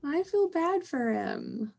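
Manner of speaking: high, drawn-out pitying coo; aww-poor-thing voice; sympathetic cooing. Real, not acted. Pitying a classmate mocked for tics.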